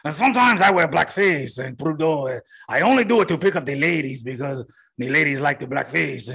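mock French accent parody; cartoonish French-accented politician; bad French accent. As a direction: keep up the clumsy fake French accent. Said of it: "It's bad French accent".

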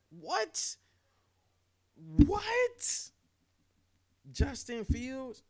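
high incredulous squeak, twice; can't believe the news; sudden high-pitched disbelief. Real, not acted. Stunned at getting Justin Fields.

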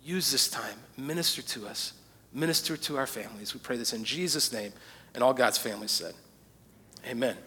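soft, low closing prayer; quiet prayer. Acted. Pastor praying softly over the congregation, hushed. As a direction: pray it quietly and humbly.

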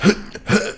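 short, sharp voiceless grunts; fighter-pilot straining breaths. Shown as a demonstration. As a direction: demonstrate forcing air out against G-forces. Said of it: "you go, like".

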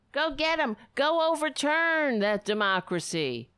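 high mock cheerleading; sarcastic rallying cry. Host pretending to spur on fist pumpers, sarcastic. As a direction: egg them on with fake zeal.

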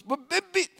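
fed up, she finally blurts it out; the student, cornered in class, blurts out her answer in exasperation; exasperated. Retold or imitated. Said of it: "in exasperation".

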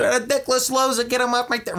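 high, jeering; mocking crowd. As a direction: sneer it up high. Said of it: "Everybody's like".

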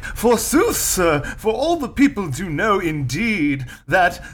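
high, slow hammy Shakespearean actor; hammy stage actor. Retold or imitated. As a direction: overact like an Elizabethan on stage. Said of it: "And he's like".

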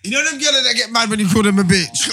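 loud worked-up rant; excited shout. Real, not acted. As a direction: raise your voice, fired up. Heated rant about girls.

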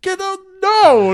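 very high, loud, drawn-out holler; hammed-up outburst; exasperated mock yell. Retold robber explodes at partner.

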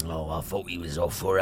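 low Cockney rat voice. Acted. Rat confessing a misunderstanding, low Cockney rat. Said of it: "as long as it's a Cockney rat".

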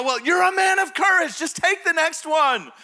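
high, loud shouted encouragement; rallying dad. Retold or imitated. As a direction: shout your child on to be brave. Dad rallies son to continue.

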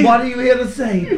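eager question. Pretend. Eager seance follow-up.

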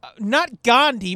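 ordinary talk

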